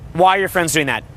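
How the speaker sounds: ordinary talk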